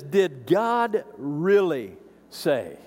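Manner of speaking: very slow, sneering "Did God really say"; slithering snake voice; sly serpent tone. Retold or imitated. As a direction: draw it out like a tempter. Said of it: "I want you to notice the tone here".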